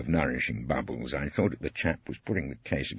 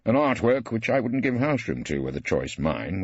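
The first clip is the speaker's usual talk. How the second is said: ordinary delivery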